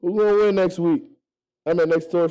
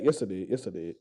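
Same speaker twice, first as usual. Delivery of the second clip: much softer and lower each time; trailing off into near silence; fading to a murmur; 10 semitones lower, 10 dB quieter than usual. Real, not acted. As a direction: let it die away under your breath. Repeats correction, fading away.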